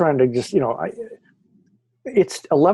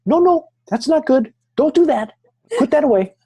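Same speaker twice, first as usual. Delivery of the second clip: retold protest in a squeaky high register; high-pitched protest; 12 semitones higher, 3 dB louder than usual. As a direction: pitch it way up, pleading to stop. Acting own alarmed protest in retelling.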